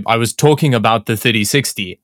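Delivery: ordinary delivery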